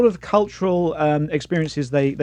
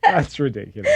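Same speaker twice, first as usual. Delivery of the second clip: laughing; 3 semitones lower than usual. Real, not acted. Laughing at meme.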